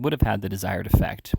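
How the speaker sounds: usual speaking voice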